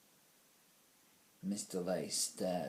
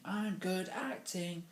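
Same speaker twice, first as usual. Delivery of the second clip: high, slow mock scream; hammed-up cry of a bad actor; 10 semitones higher, slower than usual. A narrator's reading. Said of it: "stood on a desk screaming".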